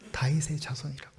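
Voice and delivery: 다윗의 자손이라고 whispered, no voice. Shown as a demonstration. Preacher whispering the beggar's messianic title to the congregation. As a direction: breathe 다윗의 자손이라고 as a hushed secret.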